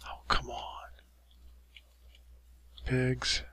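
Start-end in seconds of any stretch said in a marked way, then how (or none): 0.1-0.6 whispered groan of frustration; frustrated whisper. Real, not acted. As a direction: whisper it, fed up.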